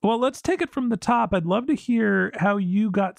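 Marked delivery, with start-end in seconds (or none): none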